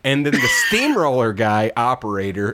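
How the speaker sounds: ordinary talk, nothing marked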